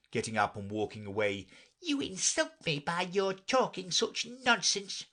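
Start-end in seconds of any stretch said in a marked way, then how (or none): 1.8-5.1 offended mouse. Acted.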